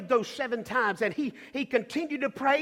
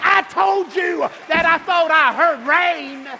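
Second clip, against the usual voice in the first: loud, slow preacher's cry; triumphant shout; 4 semitones higher, 6 dB louder, slower than usual. Acted. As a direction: shout it out in triumph, stretching each word. Prophet's triumphant cry at sermon climax.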